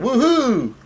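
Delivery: high-pitched mock whoop; Luffy's cheer. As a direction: squeal it out, long and high. Acting Luffy's cheer at food.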